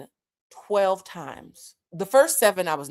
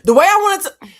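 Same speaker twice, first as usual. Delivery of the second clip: loud, high-pitched burst; worked-up comic shout; exasperated outburst; 12 semitones higher, 12 dB louder than usual. Real, not acted. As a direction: explode with pent-up frustration. Outburst about confronting the painter.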